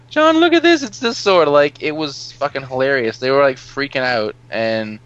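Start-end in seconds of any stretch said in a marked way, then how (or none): 0.1-1.5 put-on high, loud, excited child; excited kid voice. Kids showing each other toys, high excited mock child voice. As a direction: squeal like a kid showing off a toy.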